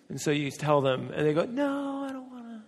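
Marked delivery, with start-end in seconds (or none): 1.5-2.6 higher, sulky put-on friend voice trailing off soft; whiny refusal. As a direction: pout it like a stubborn friend.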